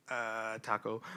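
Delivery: soft, hesitant retold nervous answer; nervous mumble.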